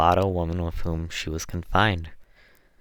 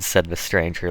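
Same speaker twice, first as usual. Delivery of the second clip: usual speaking voice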